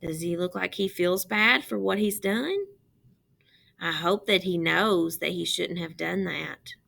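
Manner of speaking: ordinary talk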